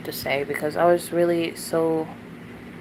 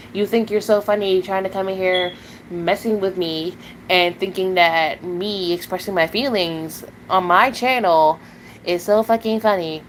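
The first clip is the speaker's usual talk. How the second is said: ordinary delivery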